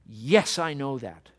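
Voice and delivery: loud, punched YES, then easing off; resounding yes. Acted. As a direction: answer with a resounding, certain yes. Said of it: "with a resounding".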